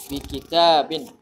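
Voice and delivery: slow chant with a long "i" in "Ituni"; brief melodic tajwid recital; Quran recitation. Shown as a demonstration. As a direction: recite "Ituni" with held vowels.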